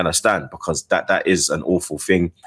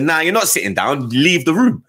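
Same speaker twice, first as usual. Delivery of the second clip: higher-pitched indignant retelling; retold indignant order; 8 semitones higher than usual. Husband retelling how he banished his laughing wife, indignant and high. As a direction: re-enact ordering someone out, voice climbing.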